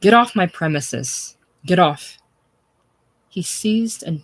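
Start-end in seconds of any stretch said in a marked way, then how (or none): 0.0-2.2 loud, furious order; a small boss shoving a big smith away. A narrator's reading. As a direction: drive him out with the voice.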